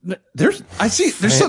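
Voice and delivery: high-pitched panicky stammer; frantic. Retold or imitated. Girlfriend hears something in the air conditioner, frantic. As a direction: imitate someone blurting in rising panic.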